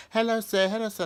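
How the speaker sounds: put-on high, sing-song kids' hello; kids greeting. Students passing at the gate, high chirpy greeting. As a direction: mimic the kids chirping hello as they pass. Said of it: "going past me and just saying".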